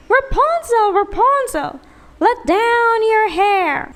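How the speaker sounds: high, loud sing-song witch call. A narrator's reading. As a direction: screech her name up the tower like a witch. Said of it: "would cry".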